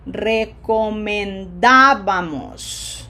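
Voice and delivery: Recomendábamos, very slow, swelling on a loud DÁ; stress on third-last syllable. Shown as a demonstration. Teacher checking students hear emphasis, slow Recomendábamos stressed on DÁ. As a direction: stretch Recomendábamos and punch the DÁ. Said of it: "one more time".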